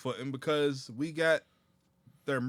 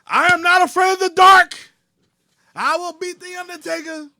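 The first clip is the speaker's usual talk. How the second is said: high, booming wrestling promo; imitated promo shout; 17 dB louder than usual. Wrestler in a promo before the match, shouted. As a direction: roar it as a mock challenge.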